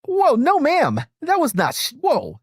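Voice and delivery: high-pitched, alarmed objection; startled protest. Real, not acted. Host objecting to the guest's remark, startled protest. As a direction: jump in high to stop a misunderstanding.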